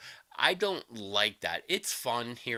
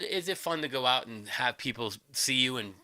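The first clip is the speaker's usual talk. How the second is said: usual speaking voice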